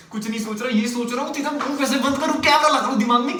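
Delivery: exasperated snap. Acted. Man answering nagging girlfriend's question, mounting exasperation. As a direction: lose patience, climbing as you go.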